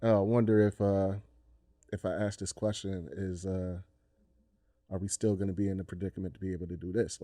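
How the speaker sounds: soft, halting mock self-doubt; quietly voiced tentative question; hesitant wondering. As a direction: mumble it like someone unsure, acting it out.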